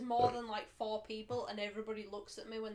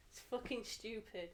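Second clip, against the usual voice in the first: ordinary delivery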